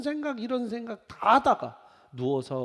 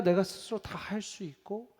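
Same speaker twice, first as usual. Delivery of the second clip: ordinary delivery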